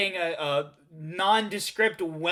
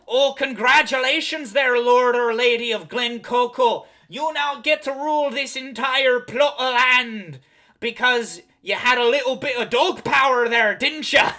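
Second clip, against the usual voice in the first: loud hammy Scottish-pirate brogue; 4 semitones higher, 6 dB louder than usual. Pretend. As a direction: bellow it like a pirate. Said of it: "Now I just sound like a pirate".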